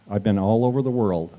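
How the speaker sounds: ordinary talk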